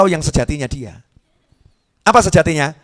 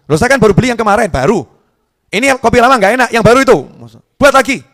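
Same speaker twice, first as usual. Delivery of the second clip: higher, louder scolding husband; angry husband; 6 semitones higher, 4 dB louder than usual. Retold or imitated. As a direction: scold the wife over the coffee. Suami marah soal kopi. (suami membentak istri soal kopi, marah)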